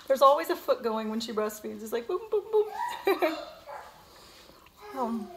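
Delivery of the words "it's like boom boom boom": giggling through the booms; laughing. Real, not acted. Mom laughing at the baby's kicking foot, giggling. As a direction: laugh as you say each boom.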